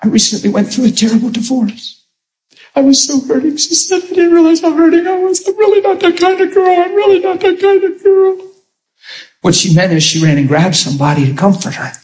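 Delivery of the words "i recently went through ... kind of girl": much higher voice as the hurting woman; preacher voicing a divorced lady's confession, high and pitiful; imitating the lady. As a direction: squeak it out high, playing her confession. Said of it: "she leaned in and she said".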